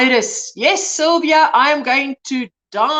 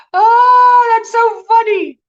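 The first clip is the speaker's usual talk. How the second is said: delighted surprise; 9 semitones higher, 7 dB louder, slower than usual. Real, not acted. Surprised by a viewer comment.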